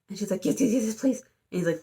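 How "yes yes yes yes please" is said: rapid-fire, higher begging; eager pleading. Retold or imitated. Girl begs ghost boy to grant her wish, eager pleading. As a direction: imitate someone desperate to agree.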